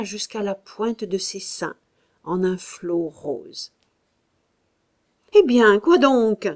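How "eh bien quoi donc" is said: loud, irritated call; annoyed shout. A narrator's reading. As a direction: snap it out, displeased. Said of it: "cria Claude mécontent". (cri agacé et mécontent)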